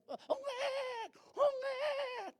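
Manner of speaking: high squealing imitation of a newborn's wail; mock baby cry. Preacher imitating a baby crying at birth.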